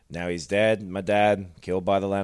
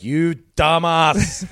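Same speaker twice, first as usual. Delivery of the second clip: slow, louder, higher exasperated insult; exasperated Tyrion; 7 semitones higher, 5 dB louder, much slower than usual. Retold or imitated. Tyrion scolding Daenerys, slow exasperated. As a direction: drag it out in disbelief.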